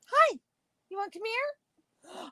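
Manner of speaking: high sing-song call to a pet; talking to a dog. Real, not acted. Calling the dog Orion.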